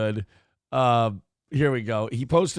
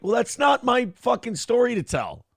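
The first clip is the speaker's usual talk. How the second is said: higher-pitched defensive retort; mock-defensive, voice pitched up; defensive protest; 7 semitones higher than usual. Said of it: "where I'm like".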